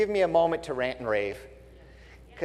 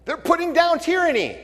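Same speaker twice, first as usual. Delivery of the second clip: higher sarcastic echo; mock claim; 13 semitones higher, 3 dB louder than usual. Sarcastic quote before "sure they are.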